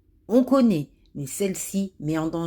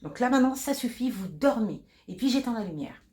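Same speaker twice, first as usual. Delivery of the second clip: curt, annoyed nurse; impatient nurse; 3 dB quieter, faster than usual. Acted. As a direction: brush off the patient's call. Nurse scolds patient in acted scene.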